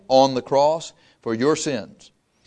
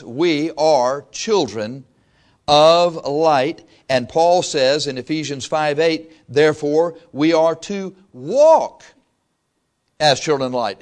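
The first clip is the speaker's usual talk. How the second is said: scripture reading; slower than usual. Acted. Reading scripture aloud slowly.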